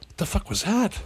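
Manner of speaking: high, hushed bewilderment; quietly thrown by a strange noise; retold startled disbelief. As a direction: pitch it high and soft, baffled. Retold startled reaction to grunt.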